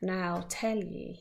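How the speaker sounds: lightly voiced old storyteller, hushed; a hint of a weary old man beginning his tale; old Once-ler voice. Once-ler starts telling the visitor his story, lightly hushed. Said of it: "with his teeth sounding grey".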